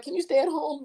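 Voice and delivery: high, put-on pleading voice; mock pleading partner. Partner begging the doctor to stay home, high and pleading. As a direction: beg him to stay home.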